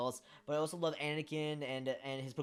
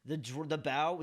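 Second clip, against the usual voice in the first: normal talking voice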